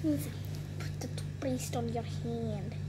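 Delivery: slow hushed whisper. Real, not acted. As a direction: breathe it out, barely voiced. Whispered aside to camera.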